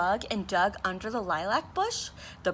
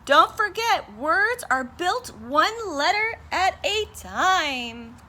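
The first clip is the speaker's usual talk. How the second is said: higher, louder, then slow and deliberate; calling out; 8 semitones higher, 4 dB louder, slower than usual. A narrator's reading. Bird calling back to Rocket from the sky, slow and clear. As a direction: call it back as she leaves. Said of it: "she called".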